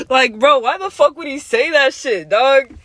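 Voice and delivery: high, loud, incredulous outburst; loud disbelief. Real, not acted. Storyteller reacting to the kid's joke, loudly incredulous. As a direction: exclaim it fresh from laughing.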